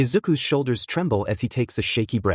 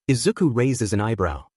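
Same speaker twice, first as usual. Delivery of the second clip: plain reading voice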